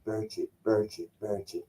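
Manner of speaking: softly rehearsing a name to himself; quiet, deliberate repetition, dropping away; Trump. Retold or imitated. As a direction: imitate a man practising in the mirror, hushed. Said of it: "that was a great impression of him".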